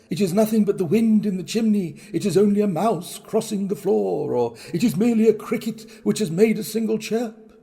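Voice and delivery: put-on a frightened old man insisting it's nothing; nervous self-reassurance. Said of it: "He had been saying to himself".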